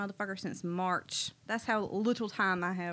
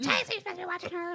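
high, fast, annoyed rebuke to husband; fed-up scolding; 12 semitones higher, 5 dB louder, faster than usual. Retold or imitated. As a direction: fire it off exasperated.

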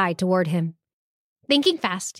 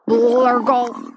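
stomach gurgle; 3 semitones higher, 3 dB louder than usual. Acted. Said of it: "came the answer".

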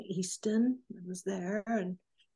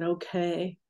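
lower, reluctant retold okay; begrudging okay; 4 semitones lower than usual. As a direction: imitate someone grudgingly giving in. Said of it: "he kind of begrudgingly said".

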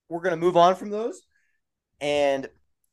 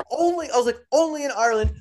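amazed; 8 semitones higher than usual. Retold or imitated. Retold own amazed remark.

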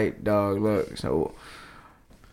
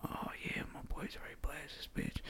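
fast, much softer whisper; 4 semitones higher, 21 dB quieter than usual. Retold or imitated. Hunter thinking aloud in the blind, whispering. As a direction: rush it under your breath. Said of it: "We had to whisper".

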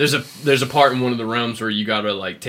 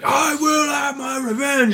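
mock rage roar; 3 dB louder, slower than usual. Mocking old angry Kratos.

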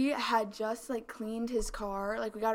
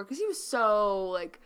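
ordinary talk, nothing marked

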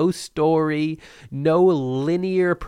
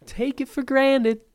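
high-pitched mock indignant repeat; high, put-upon echo; 9 semitones higher than usual. Repeats it mockingly right after.

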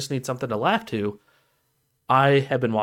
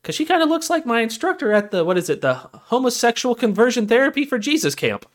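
put-on much higher, sugary church-girl voice; prim churchgoing girl; 11 semitones higher, 3 dB louder than usual. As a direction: falsetto pious girl. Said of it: "She's like".

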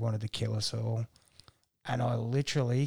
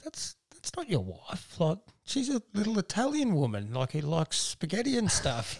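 mock astonished coworkers; 7 semitones higher, 3 dB louder than usual. Coworkers quoted in disbelief.

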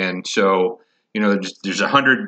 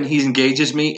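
ordinary talk

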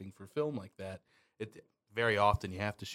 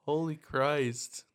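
shocked; 4 semitones higher, 5 dB louder, much slower than usual. Real, not acted. Reacts to the dark joke.

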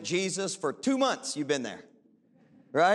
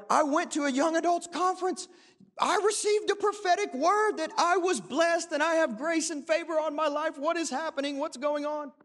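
mock young believer; 9 semitones higher than usual. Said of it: "where we're like".

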